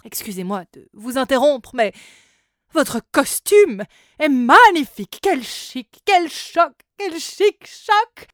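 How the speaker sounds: high, loud fashion seller; flamboyant salesman. Acted. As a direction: gush over the costume. The hotel clothes seller.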